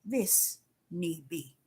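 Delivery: calm and deliberate divine answer; hushed, unhurried reply to Deborah; God's gentle voice. Retold or imitated. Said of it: "He have to tell me".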